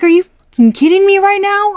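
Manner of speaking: furious outburst. Retold or imitated. Reader voicing a fed-up viewer at the suitor, furious. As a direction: blow up in disbelief. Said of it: "you are pissed".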